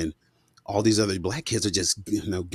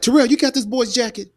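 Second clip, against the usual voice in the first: put-on much higher and louder, calling a child out; stern mother; 5 dB louder than usual. Terrell's mother confronting her son, much higher, demanding. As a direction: demand it like a mom at the door. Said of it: "She's like".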